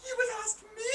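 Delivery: high, soft, near-tearful disbelief; choked-up surprise; touched surprise. Acted. Asked to be deputy.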